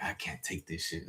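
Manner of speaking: quiet panicked thought; inner panic. Retold or imitated. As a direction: say it to himself under his breath. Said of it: "I'm panicking in my head".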